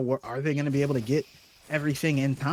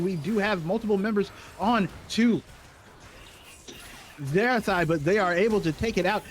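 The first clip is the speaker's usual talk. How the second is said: raised-pitch, running team-fight call; live play-by-play; 5 semitones higher than usual. Real, not acted.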